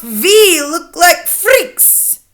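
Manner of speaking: high, loud, stretched-out shout; drawn-out theatrical cry. A narrator's reading. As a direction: shout it as an appalled child. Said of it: "Another shouts".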